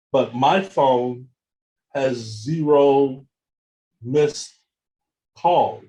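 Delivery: "my phone has zero missed calls" spaced word by word; slow, weighty emphasis on "zero"; slow emphatic stress. Real, not acted. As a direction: lay out each word with a pause. Punchline, words spaced out.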